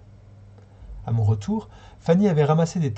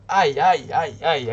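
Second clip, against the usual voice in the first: mild cry; much slower than usual. A narrator's reading. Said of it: "a crié Fanny".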